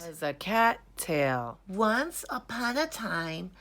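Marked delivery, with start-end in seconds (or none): none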